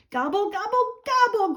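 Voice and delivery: high, gobbling turkey grumble; grumbled gobble. Acted. Turkey turned away again, grumbling: high gobble. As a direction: gobble it in frustration. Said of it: "grumbled Turkey".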